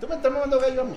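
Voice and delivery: high Caribbean Colombian voice; a hint of Barranquilla speech; coastal Colombian accent. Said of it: "en la cosa".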